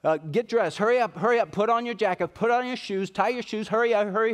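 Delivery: a mother hurrying her dawdling son out the door; nagging hurry-up. Retold or imitated. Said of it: "and I always say".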